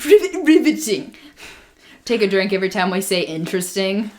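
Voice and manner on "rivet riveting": mock posh British. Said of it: "Why am I doing a British accent?".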